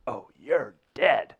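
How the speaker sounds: slow, loud imitation of doom; gloating threat. Teacher to student as coach walks by, gloating. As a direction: draw it out as a mock threat.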